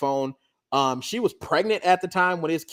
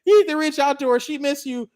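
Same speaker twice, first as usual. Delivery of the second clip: higher, urging mother voice; imitating the mom; 8 semitones higher, 3 dB louder than usual. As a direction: her mom nudging him to call. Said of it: "she like".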